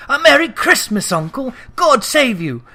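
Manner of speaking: put-on young nephew bounding in with a merry shout; cheerful greeting. A narrator's reading. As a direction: burst in full of festive cheer. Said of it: "cried a cheerful voice".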